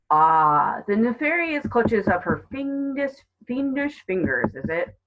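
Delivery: hammed-up relish in an officer's voice; amused policeman. A narrator's reading. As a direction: play along with mock drama. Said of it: "the smile in his voice".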